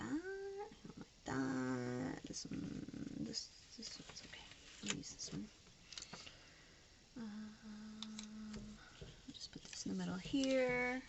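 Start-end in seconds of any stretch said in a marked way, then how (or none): 0.0-2.2 drawn out. Real, not acted. Repeated sticker disapproval. 2.3-9.9 soft, breathy whisper. Real, not acted. Vlogger choosing a sticker, whispering to herself.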